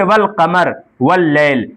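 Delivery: slow, with the l in वल कमर and वल लैल said plainly; वल लैल with a clear l before the second word; clearly sounded lam. Shown as a demonstration. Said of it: "वाज़ेह तौर पर पढ़ा भी जाता है".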